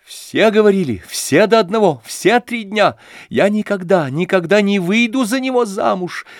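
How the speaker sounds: высоко и громче, на надрыве; выкрикнуть сквозь слёзы; прокричав. A narrator's reading. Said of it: "Прокричав это". (Аглая кричит сквозь слёзы)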